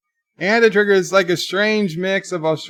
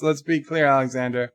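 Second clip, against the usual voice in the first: ordinary delivery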